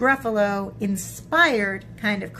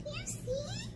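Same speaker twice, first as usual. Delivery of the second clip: high, soft little squeak; smug mouse; 12 dB quieter than usual. Acted. Mouse proving its boast to Gruffalo, high and smug. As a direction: gloat quietly in a high voice.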